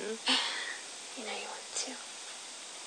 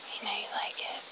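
teasing whisper; 12 dB quieter, faster than usual. Acted. Teasing partner whispers playfully.

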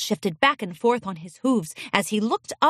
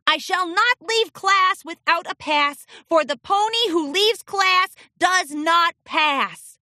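reciting colt; 7 semitones higher than usual. Acted. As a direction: chant the rule like a drilled pupil. Colt reciting rule on order.

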